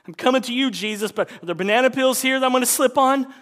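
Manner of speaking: high, loud and fast fretful prayer; hammed-up nervous voice; mock anxious pray-er. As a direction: play a silly worried believer. Mock worried prayer about peels.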